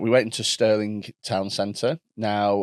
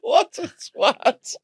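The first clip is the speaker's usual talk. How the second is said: laughing scorn; much slower than usual. Real, not acted. Laughing disbelief.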